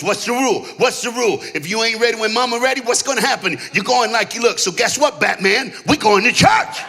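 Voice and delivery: acted dad, building to a shout; dad's scolding. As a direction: fire off the rule in triumph.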